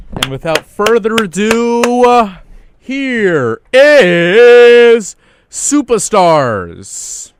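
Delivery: big, slow hype announcer. Pretend. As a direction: announce the track like a radio host. Radio host announcing the song.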